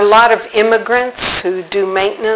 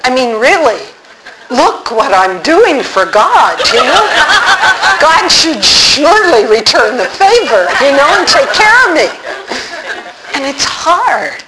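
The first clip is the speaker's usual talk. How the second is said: mock self-pity; 5 semitones higher than usual. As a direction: ham up the martyr's complaint to God. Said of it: "aren't you tempted".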